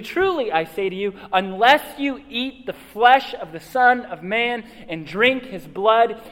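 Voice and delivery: slow, loud, solemn reading of scripture. Acted. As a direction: read the passage with weight. Reading scripture aloud to congregation.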